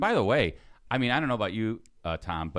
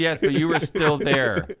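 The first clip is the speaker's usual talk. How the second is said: imitating mom; 6 semitones higher, 7 dB louder, slower than usual. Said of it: "she says".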